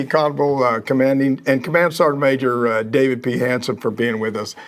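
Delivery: ordinary delivery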